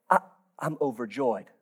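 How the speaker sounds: very low and quiet, no joy at all; deadpan mumble. Retold or imitated. Churchgoer mumbling overjoyed with no joy, flat and hushed. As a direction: drone the word overjoyed as if bored. Bad day at someone's house.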